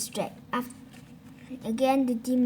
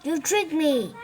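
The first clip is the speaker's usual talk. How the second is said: raised, higher accusing bark; a pig's furious accusation; barking angrily; 5 semitones higher, 5 dB louder, slower than usual. Acted. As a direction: snap it at him in anger. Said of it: "He barked at Wukong".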